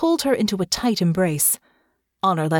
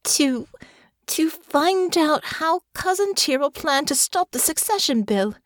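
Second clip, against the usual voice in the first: put-on dazed girl; 9 semitones higher than usual. Honor dazed, recalling.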